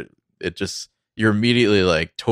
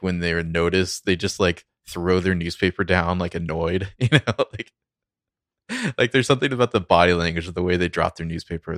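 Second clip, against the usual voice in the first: ordinary talk, nothing marked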